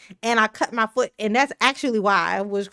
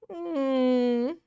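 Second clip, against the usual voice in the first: ordinary delivery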